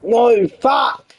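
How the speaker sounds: loud, high-pitched outburst of swearing; frustrated shout. Real, not acted. Player cursing at a setback, frustrated shout. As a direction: yell it out in real frustration.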